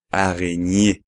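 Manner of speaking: araignée with gn as ñ; araignée said aʁeɲe; French araignée. Shown as a demonstration. Teacher modelling araignée for students. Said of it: "que es araignée".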